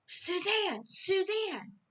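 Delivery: screaming a name. Retold or imitated. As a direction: cry her name out urgently from far above. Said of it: "He was that one voice screaming".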